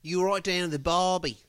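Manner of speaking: mock Australian accent, pitched well up; high-pitched Australian accent. Shown as a demonstration. Showing his friend how to sound Australian, in a high Australian accent. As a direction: put on a high, tight Australian twang. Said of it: "have a high-pitched voice".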